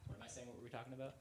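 off mic, before taking it; distant, far from the microphone; mic handed over. Real, not acted. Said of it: "You wanted to pass the mic to [name]?".

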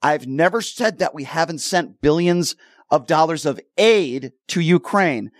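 slow, measured, word by word. Real, not acted. Host setting the caller straight so he can understand, very slowly. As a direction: spell it out slowly, as if for someone dim. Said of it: "say this very slowly".

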